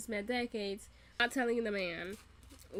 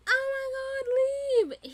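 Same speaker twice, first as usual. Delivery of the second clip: loud, drawn-out high squeal of alarm; freaking out; 8 dB louder, slower than usual. Retold or imitated. Fan begging a character to flee, panicked squeal. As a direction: wail it slowly in comic panic. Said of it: "freaking out".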